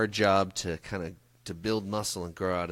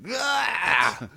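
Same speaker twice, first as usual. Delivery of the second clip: sudden high mock yelp of a crack user; a short high joke shriek; mock scream; 14 semitones higher, 5 dB louder than usual. Joking on the podcast about what crack means, mock scream.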